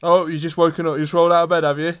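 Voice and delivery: loud mimicry of a teasing remark; indignant imitation of the co-host; mocking quote of co-host. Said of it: "you've just openly said".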